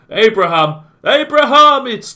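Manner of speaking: rising excited shout. Real, not acted. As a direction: cry out as the chance flies by. Near miss in commentary.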